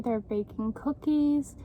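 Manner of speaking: ordinary delivery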